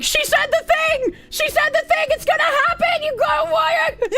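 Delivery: high, frantic cheering squeal; excited mods. Retold or imitated. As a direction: shriek it like a thrilled fan. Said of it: "it's like".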